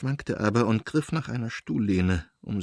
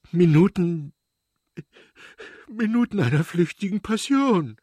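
high, anguished outburst; blurted out; 8 semitones higher, 5 dB louder than usual. A narrator's reading. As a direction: force the words out in distress. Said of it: "stieß er hervor". (gequält hervorgestoßen)

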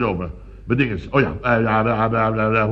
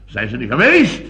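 rising, loud outrage; indignant; 6 semitones higher, 4 dB louder than usual. Acted. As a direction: climb into affronted disbelief. Indignant that burglars never came.

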